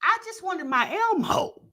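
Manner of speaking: put-on high whiny little-kid voice; be the child who only wants his Elmo; toddler voice. Commentator voicing the toddler wanting his Elmo, high childish voice. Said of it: "looking like".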